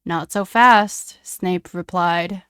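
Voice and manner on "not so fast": slow, loud and smug; menacing. Acted. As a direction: drawl it as a threat. Snape blocking James.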